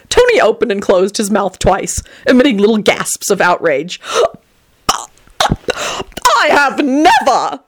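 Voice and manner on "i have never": screech. A narrator's reading. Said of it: "he screeched".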